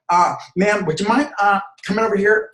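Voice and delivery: high, hesitant, jittery voice; nervous guard stammering at a customer; nervous security guard. Shown as a demonstration. Guard nervously asks a customer to come over, high and hesitant. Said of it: "extremely nervous security guard".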